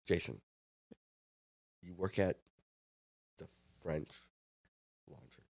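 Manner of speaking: loom over them with long, ominous pauses; imagined stern boss. Retold or imitated. Imagined head chef questioning his cook, towering, quiet menace. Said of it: "towering over me".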